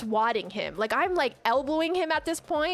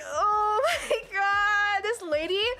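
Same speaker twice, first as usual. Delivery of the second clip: overwhelmed, tearful wail; 9 semitones higher, slower than usual. Retold or imitated. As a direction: wail it slowly, as if bursting into tears. Overwhelmed by the lady's kind words.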